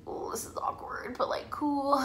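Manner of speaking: whispered awkward aside. Retold or imitated. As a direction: whisper it like an uneasy private thought. Retold awkward inner reaction, whispered.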